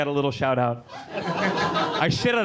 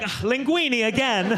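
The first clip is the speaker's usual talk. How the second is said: put-on Italian accent; 7 semitones higher, 3 dB louder than usual. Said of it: "You're opening them up like".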